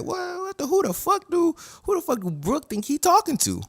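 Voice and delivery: very high-pitched mock indignation; outraged disbelief. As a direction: squeal it like an affronted villain. Voicing Big Mom's outraged reaction.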